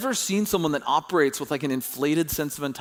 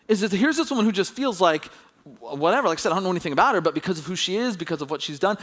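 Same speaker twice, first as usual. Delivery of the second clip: usual speaking voice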